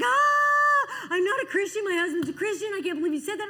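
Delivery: loud high crying outburst, acted; hammed-up high, loud sobbing protest; distraught wail. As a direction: imitate a woman wailing in upset. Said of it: "She's like".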